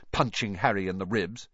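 ordinary delivery, nothing marked

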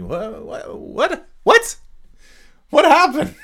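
high, squeaky bewildered yelps; comic high-pitched shock; mock disbelief. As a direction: squeal it out, dumbfounded, tipping into laughter. Mock disbelief at wrestler's changed persona.

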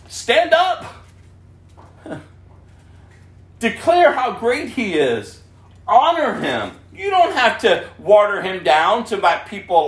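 high, loud, driving preacher voice; fiery sermon delivery; full-force preaching. Acted. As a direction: preach it out to the whole room.

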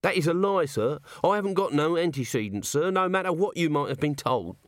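offended little corporal; Nobby indignant. A narrator's reading. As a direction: protest innocence hotly.

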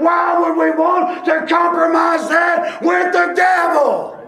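high, loud shouted preaching, slowing on the last lines; full-force pulpit climax; fiery preaching. Acted. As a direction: drive the point home to the church.

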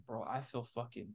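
low, drawn-out queasy moan; mock sick groan, very soft and slow. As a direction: groan it like you're about to throw up. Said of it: "And I was like".

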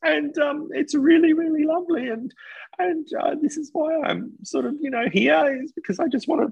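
very high, teary put-on voice of a weepy contestant; crying contestant impression. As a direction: play an emotional woman close to tears over her dish. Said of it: "And I don't know why I'm crying".

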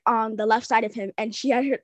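ordinary talk, nothing marked

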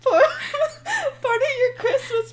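high, slow laughing-crying words. Real, not acted. Revealing the Christmas present to her friend, laughing and crying. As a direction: squeeze it out between laughs.

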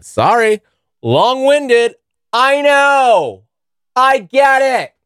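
high, sing-song put-on voice; mock whiny apology. Apologizing to viewers for rambling, silly high whine. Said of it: "apologizing to viewers mockingly".

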